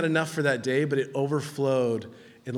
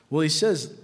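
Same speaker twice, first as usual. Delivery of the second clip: usual speaking voice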